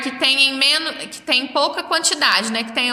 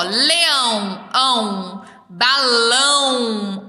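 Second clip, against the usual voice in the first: LEÃO and BALÃO very slow, with a long held ão; ending sounded out; much slower than usual. Shown as a demonstration. Teacher showing children the same sound, ending sounded out. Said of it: "tem a mesma terminação sonora".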